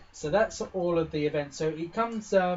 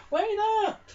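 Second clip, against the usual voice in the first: much higher, louder call from afar; 6 dB louder than usual. Acted. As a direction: shout across the gate to stop them. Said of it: "they call out to you".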